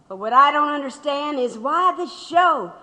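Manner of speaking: usual speaking voice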